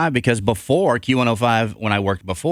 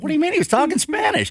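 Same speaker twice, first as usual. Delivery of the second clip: incredulous. Retold or imitated. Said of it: "I'm like".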